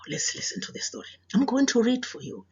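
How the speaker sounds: ordinary talk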